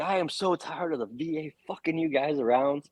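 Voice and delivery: a mock rant from a fed-up doctor. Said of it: "He was just furious".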